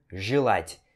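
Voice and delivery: желать said "jilát", E as an I; E com som de I. Shown as a demonstration. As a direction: give the first vowel of желать an I sound. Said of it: "pronunciado com som de I". (желать com "ji" na primeira sílaba)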